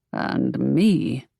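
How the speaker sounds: a hint of a growl. Draco thumbing through her sketches, mild growl. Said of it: "he said with a growl".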